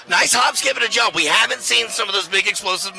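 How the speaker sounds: high, lifted play-by-play burst; excited call. Real, not acted. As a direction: get excited on the move.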